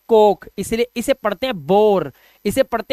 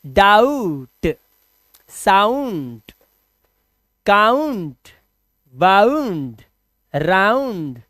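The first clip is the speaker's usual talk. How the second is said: slow demo; much slower than usual. Shown as a demonstration. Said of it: "जैसे doubt".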